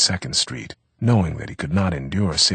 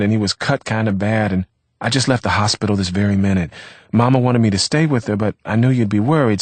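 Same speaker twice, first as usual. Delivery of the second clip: higher, louder chatty woman's voice; imagined girlfriend's excuse; 4 semitones higher, 5 dB louder than usual. A narrator's reading. Girlfriend explaining her lateness to Vivaldo, higher and chatty. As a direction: rattle off her family excuse. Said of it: "she would say".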